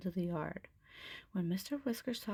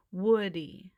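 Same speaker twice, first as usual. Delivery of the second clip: drawn-out, loud Woody; slowly; 8 dB louder than usual. Acted. Buzz patiently repeating the name to the owl, slowly. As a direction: spell it out for the owl. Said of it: "Buzz repeated slowly".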